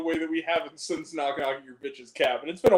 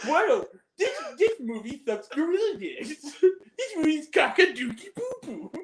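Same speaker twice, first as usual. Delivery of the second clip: high laughing mock rant; Angry Video Game Nerd rant; 8 semitones higher than usual. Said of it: "the spoof of Angry Video Game Nerd".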